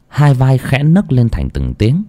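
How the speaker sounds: usual speaking voice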